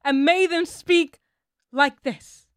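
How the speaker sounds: loud, slow, high mock ranting lecture; hammed-up impression of a ranting preacher; mock Doctor Umar rant. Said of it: "he was emphasizing that British colonizer accent".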